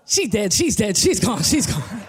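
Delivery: low, repeated bad news; insistent repeated report. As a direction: imitate her, low and insistent. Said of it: "The woman goes".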